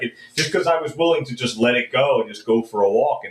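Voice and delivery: normal talking voice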